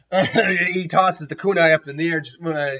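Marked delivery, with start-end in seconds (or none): none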